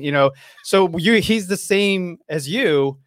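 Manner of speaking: usual speaking voice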